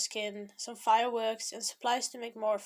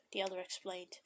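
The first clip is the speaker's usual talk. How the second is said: whispering; 4 semitones lower, 8 dB quieter than usual. A narrator's reading. Whispered narration tag.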